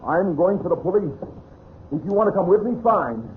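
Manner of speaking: high-pitched, urgent defiance; heated insistence. Acted. Husband leaving for the police at gunpoint, heated. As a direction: force the issue despite the threat.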